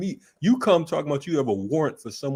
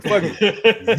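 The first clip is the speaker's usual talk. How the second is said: mock cop; 7 semitones higher, 5 dB louder than usual. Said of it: "You're like".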